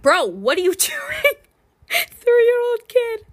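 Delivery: loud, high mock protest cracking into laughter; drawn-out, incredulous scolding through a laugh; laughing disbelief. Babysitter confronting a toddler peeing everywhere, laughing disbelief.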